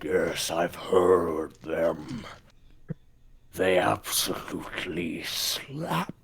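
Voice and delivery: slow, low, whispery monster voice; kraken. Acted. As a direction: drag it out like a sea beast. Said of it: "I need to hear the Kraken voice".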